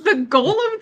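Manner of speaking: high, bursting yes; excited yes. Real, not acted. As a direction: leap in with delight. Excited agreement about golems.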